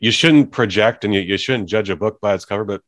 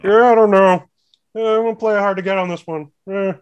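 a pitched-up impression of the sitcom mailman dodging a woman; Cliff Clavin impression; 10 semitones higher, 5 dB louder than usual. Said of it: "That's my Cliff Clavin".

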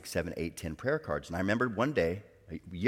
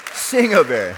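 loud, high exclamation of the verse; triumphant shout; 7 semitones higher, 16 dB louder than usual. Retold or imitated. Preacher celebrating that the Lord answered, triumphant shout.

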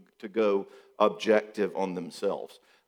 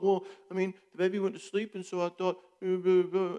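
higher, flustered stammering excuses; stumbling compliant husband; 8 semitones higher than usual. Retold or imitated. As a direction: trip over your words to please her. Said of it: "he starts stumbling all over himself".

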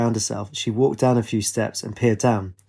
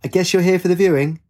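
higher, moderately raised calling voice; restrained shout, pitched up; 7 semitones higher than usual. A narrator's reading. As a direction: call down the stairs to a visitor. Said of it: "Katie shouted".

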